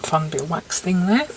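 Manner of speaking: normal talking voice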